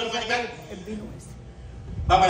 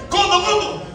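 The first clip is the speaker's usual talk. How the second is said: higher-pitched call to brother; 9 semitones higher, slower than usual. Acted. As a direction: call out to your little brother. Calls his brother in the story. (llamando al hermano, voz aguda)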